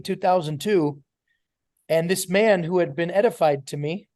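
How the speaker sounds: usual speaking voice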